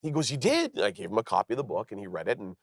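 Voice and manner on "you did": high, amazed question; surprised. Retold or imitated. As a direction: sound genuinely taken aback. Paul surprised by the book.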